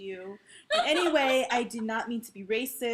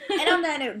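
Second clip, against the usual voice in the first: emphatic echo; 6 semitones higher, 8 dB louder than usual. Shown as a demonstration. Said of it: "echoing outro".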